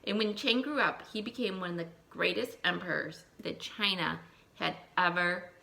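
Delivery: put-on lively read-aloud for young children; storybook reading. Teacher reading a folk tale to her class, lively storytelling.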